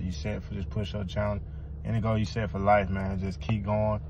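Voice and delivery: usual speaking voice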